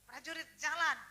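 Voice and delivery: loud marching order; rallying command. Retold or imitated. Doctor rallies prayer friends as soldiers, loud and commanding. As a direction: call the troops out.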